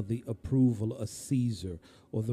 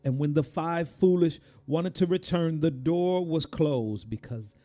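raised, louder pulpit delivery; forceful preaching; 6 semitones higher, 6 dB louder than usual. Real, not acted. Preacher asks congregation if they are living ready, forceful preaching.

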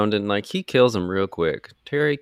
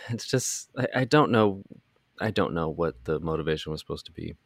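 ordinary delivery

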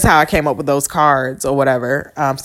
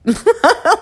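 short laugh. Real, not acted. Laugh after retold quote.

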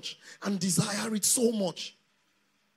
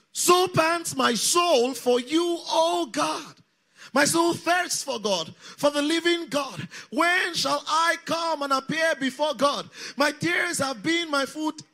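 loud, slow, raised declamation; congregational scripture reading; 6 semitones higher, 10 dB louder, slower than usual. Real, not acted. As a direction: lead the crowd in reading scripture aloud. Leads congregation reading the psalm aloud.

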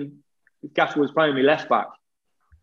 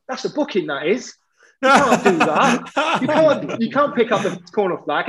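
mock outraged protest; 7 semitones higher than usual. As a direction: ham up a cheeky teammate's fake outrage. Sealy mock-protesting to the ref.

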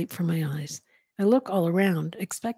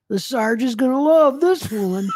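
loud, higher, mock-gleeful sarcasm; sarcastic; 6 semitones higher, 7 dB louder than usual. A narrator's reading. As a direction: crow it sarcastically like a gruff cop. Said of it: "he sarcastically remarks".

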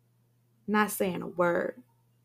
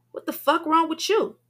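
sharp, raised angry question; 1 angry demand; 9 semitones higher, 6 dB louder than usual. A narrator's reading. Lucky confronting Monica, angry and loud. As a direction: snap at Monica as she storms in. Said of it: "Lucky asks her".

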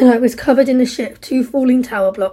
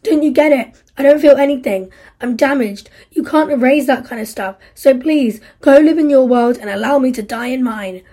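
furious despair; 3 semitones higher, 7 dB louder than usual. Acted. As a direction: drive her away for good. Steve pushes Isla away.

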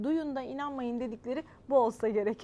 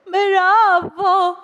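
high, very loud, long bravo; shouted cheer; 7 semitones higher, 17 dB louder than usual. Acted. Spectator applauding the dancing dog, shouting. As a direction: cheer at full voice.

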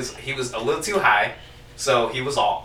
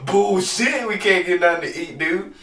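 higher and a little louder, scoffing at her words; mocking disbelief; 6 semitones higher, 3 dB louder than usual. Husband mocking his wife's complaint with scoffing disbelief. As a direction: throw her line back at her, incredulous. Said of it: "And I'm like".

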